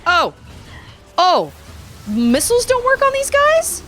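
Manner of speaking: high, loud startled yelp; real shock, pitched high; surprised. Real, not acted. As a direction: caught off guard by the enemy. Enemy shrugs off missiles.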